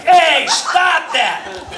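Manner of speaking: loud, high, drawn-out scolding; shouted reprimand. Acted. Ventriloquist stops puppet heckling a lady, shouted reprimand. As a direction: cut them off with a slow, loud shout.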